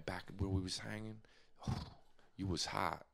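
low, soft, deep lover-man murmur; deep pickup voice. Pretend. Buddy demonstrating a seduction line, low and husky. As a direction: croon low like a smooth player. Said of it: "Or like how, like this".

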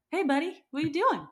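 husband calling a stranger from the car window. Retold or imitated. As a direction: call out to the guy, wary. Richard calls to the stranger.

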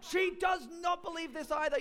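high, loud taunt; mocking retort. Brother retorts about mum.

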